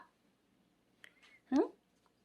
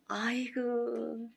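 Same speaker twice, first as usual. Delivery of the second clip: whispering; 5 dB quieter, much slower than usual. Real, not acted. Cooing over the cat.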